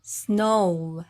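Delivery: "snow" slightly slow, blended; "snow" yavaşça tek hece; English snow. Shown as a demonstration. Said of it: "snow라고 발음하시면".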